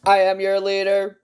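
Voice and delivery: robotic overlord greeting the troops; mock alien leader. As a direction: play a pompous leader from outer space. Alien leader bit to viewers.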